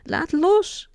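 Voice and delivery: put-on girl screaming to let go of the crocodile; shrieking. A narrator's reading. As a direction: scream the warning across the sea. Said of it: "gilt Mel in de verte".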